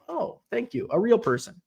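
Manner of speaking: normal talking voice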